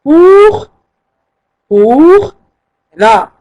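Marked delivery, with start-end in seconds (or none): 0.0-2.3 very slow souvent, then a high, louder Pour; teacher repeating the syllables for the class. Shown as a demonstration.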